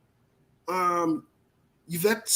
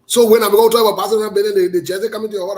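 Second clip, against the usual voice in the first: excited hype; 3 semitones higher, 9 dB louder than usual. Real, not acted. Hyping up Barcelona.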